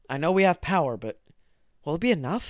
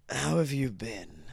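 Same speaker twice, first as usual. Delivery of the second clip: lightly sinister voice; haunting voice; 5 dB quieter than usual. Acted. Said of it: "such a dark, haunting voice".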